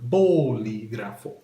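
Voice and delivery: bolígrafo stressed on BO, drawn out; stress on first syllable. Shown as a demonstration. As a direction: say bolígrafo slowly as BO-li-gra-fo. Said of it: "underline where you pronounce the word".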